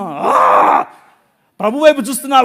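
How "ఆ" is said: a raw yell of frustration; anguished yell. Retold or imitated. Pastor by the roadside yelling at God, anguished yell. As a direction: let out one long cry. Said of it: "గట్టి గట్టిగా అరుస్తున్నా".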